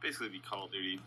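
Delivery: tinny clip through phone speaker; phone speaker playback. Real, not acted. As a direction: audio played from a phone. Playing own video through phone speaker.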